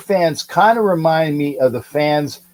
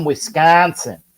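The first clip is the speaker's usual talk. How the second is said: put-on Wisconsin accent on "Wisconsin"; 2 semitones higher, slower than usual. Shown as a demonstration. Host teasing about Clayton's home state, mock Wisconsin accent. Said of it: "accent like that".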